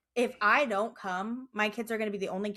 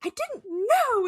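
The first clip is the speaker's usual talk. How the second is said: high, loud, drawn-out protest; mad kid; 13 semitones higher, 5 dB louder, slower than usual. Retold or imitated. Mom imitates her daughter getting all mad, high indignant whine. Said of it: "she starts getting all mad".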